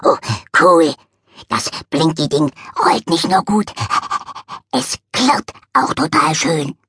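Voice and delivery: playful dog, marveling at the clinking; gleeful puppy at play; dog delighted by a rolling screw; happy dog voice. Acted.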